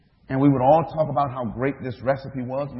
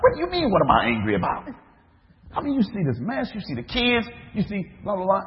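exasperated protest; 8 semitones higher, faster than usual. Retold or imitated. Preacher answering God back, exasperated high fast protest. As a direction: fire back in a huffy rush, pitched up.

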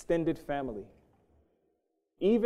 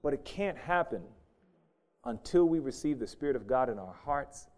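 ordinary talk

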